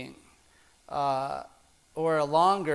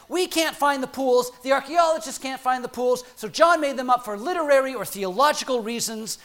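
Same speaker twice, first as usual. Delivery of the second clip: higher, louder mock-cranky insistence; cranky scholars; 6 semitones higher, 4 dB louder than usual. Preacher quoting cranky German scholars, mock-indignant insistence. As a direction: protest like an irritated academic. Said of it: "These cranky German scholars were saying".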